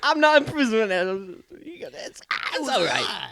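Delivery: very high, loud babbling impression; mock wailing impression, pitched far up; Bill Cosby. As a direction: blurt and splutter in a hammed-up squeal. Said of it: "he'd be like".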